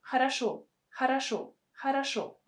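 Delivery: quick, reduced "хорошо", first vowel nearly swallowed; fast spoken speech. Shown as a demonstration. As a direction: say "хорошо" as in rapid everyday talk, huh-ra-SHO. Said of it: "In a fast-spoken speech".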